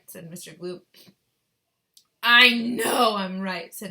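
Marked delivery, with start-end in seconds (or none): 2.2-3.7 loud, higher, drawn-out retort; insistent shout. A narrator's reading. Frantic mother insisting to her husband, shouting. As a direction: shout it slowly and emphatically.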